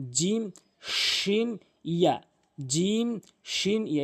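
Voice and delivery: জিম, শিন held long and high from the middle of the tongue; slow teacher model of জিম and শিন; Arabic letter demo. Shown as a demonstration. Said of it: "এই তিনটি হরফ একই রকম".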